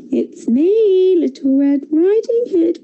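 the wolf pretending to be the girl; wolf faking a girl. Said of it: "pretended the wolf".

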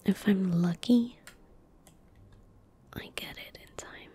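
usual speaking voice

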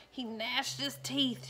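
ordinary delivery, nothing marked

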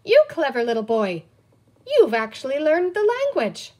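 put-on loud, high-swinging praise of a baby; excited exclamation. A narrator's reading. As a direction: exclaim it with amazed pride. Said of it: "Penny exclaimed".

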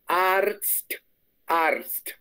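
"Arzt" lento, con R y luego TS al final bien marcados; "Arzt" con la Z sonando como ts tras la R; pronunciación alemana. Shown as a demonstration. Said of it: "Entonces se dice". ("Arzt" pronunciado despacio, con la r y la ts claras)

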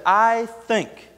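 a firm, sure I think; firm and confident. Retold or imitated. Paul answering King Agrippa, firm and confident. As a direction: say it like you mean it. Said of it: "There was a firmness in his speech".

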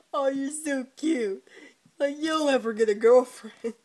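patronizing tease. Retold or imitated. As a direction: coo at him then crush him loudly. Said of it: "He's like".

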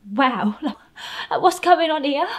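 loud, high-pitched disbelief; retold bewilderment. Victim reacting to captor's sudden kindness, bewildered. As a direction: blurt it out high and loud, baffled.

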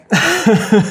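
bursting, high, loud laugh; real laugh. Real, not acted. Podcaster laughing after telling a touching story, real laugh. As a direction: let out a real laugh of amazement.

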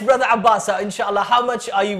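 normal talking voice

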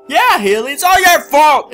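loud, high accusing shout; angry shout. Acted. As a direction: yell the blame at her. Blaming Haley loudly.

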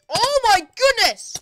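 high, loud, slow exclamation; astonished outcry, drawn out; shocked shout. Real, not acted. Shocked by game event.

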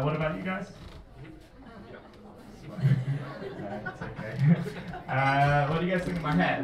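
ordinary talk

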